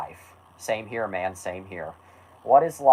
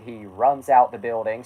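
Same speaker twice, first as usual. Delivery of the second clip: ordinary delivery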